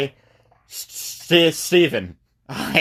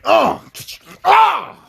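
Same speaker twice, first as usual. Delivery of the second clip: high, loud mock cries; high-pitched mock pain sounds; stabbing grunts; 11 semitones higher, 4 dB louder than usual. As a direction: voice the hammed-up stab in high yelps.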